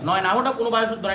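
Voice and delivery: ordinary talk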